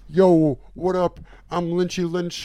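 high, loud hype rapper intro. Pretend. Mock rapper intro as Lynch.

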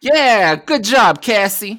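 loud, high praise; cheering. Acted. A Ranger cheering Cassie after the fight, loud and high. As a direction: cheer her on after the win.

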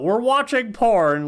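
high, drawn-out mock announcement; mock yell. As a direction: call it out slowly as a pretend alarm. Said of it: "doesn't yell".

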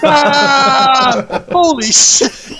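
high, slow hammed-up alarm; mock panic. Bandits spotting the orc horde, hammed-up alarm. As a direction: cry out like a bandit seeing fifty orcs.

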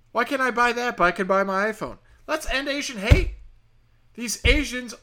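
high, loud-edged comic rant; mock outrage. Comedian demanding the right to buy diamonds, mock outrage. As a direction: protest with hammed-up indignation.

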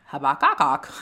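Habakkuk garbled into hah-bah-kah-kah; fumbling Habakkuk with extra kak sounds; tongue-tied name. Shown as a demonstration. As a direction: stumble over Habakkuk, repeating the end syllables. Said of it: "I could not".

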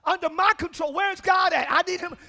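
loud, rousing sermon voice; fiery preaching. Real, not acted. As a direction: preach it out to the congregation. Preaching at full force.

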